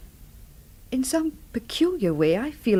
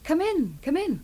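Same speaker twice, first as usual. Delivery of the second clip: higher, soft welcoming call; welcoming hostess; 8 semitones higher, 4 dB quieter than usual. Acted. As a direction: bid the guest inside kindly. Landlady welcoming visitor at door.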